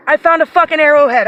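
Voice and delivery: loud, elated announcement; excited shout. Real, not acted. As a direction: yell it with joy. First announcement of the find.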